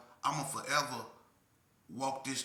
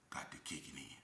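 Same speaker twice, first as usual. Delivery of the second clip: whispered; 11 semitones lower, 16 dB quieter than usual. Real, not acted. Unvoiced drop.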